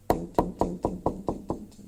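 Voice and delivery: light tapping ting ting sounds; mimicked chisel tapping. Pastor imitating the faint sound of chiseling stone, light tapping. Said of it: "이런 소리예요".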